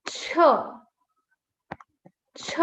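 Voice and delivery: CH as a short puffed "chuh"; letter Q sound. Shown as a demonstration. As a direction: puff CH out with a brief uh. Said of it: "letter Q is pronounced as".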